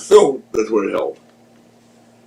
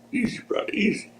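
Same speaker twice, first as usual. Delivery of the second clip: hushed coaxing; 11 semitones higher, 10 dB quieter than usual. Retold or imitated. Jimmy calming partner in story.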